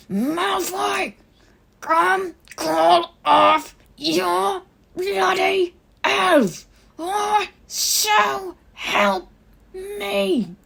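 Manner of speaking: very high, loud, slow roar. Acted. Harry roaring for Malfoy to call off his elf. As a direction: scream it up the staircase. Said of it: "roared with all his might".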